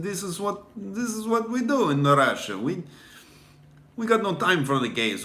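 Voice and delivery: a hammed-up Russian tough guy; Russian accent. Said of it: "A Russian accent scares us".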